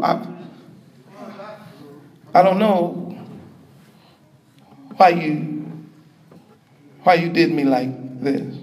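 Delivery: low, halting, wounded murmur; hurt husband. Acted. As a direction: break the plea into quiet fragments. Hurt husband pleading with his wife.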